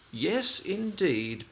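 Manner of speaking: slow, high, puffed-up boast; smug little engine bragging; boastful. Acted. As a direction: show off, drawing it out. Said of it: "boasted Thomas".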